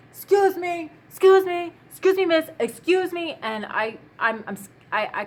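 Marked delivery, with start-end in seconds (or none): 0.1-3.4 high, loud mock yell of a stranger; imitated yelling. Stranger yelling after a woman at night, mock yell. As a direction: call out insistently in a raised, higher voice. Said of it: "this guy starts yelling".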